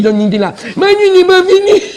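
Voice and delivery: normal talking voice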